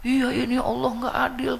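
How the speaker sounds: high hammed-up lament; imitated wailing complaint. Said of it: "Tuh, ngeluh".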